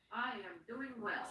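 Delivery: barely audible repeat; almost silent. Shown as a demonstration. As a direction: say it again under your breath.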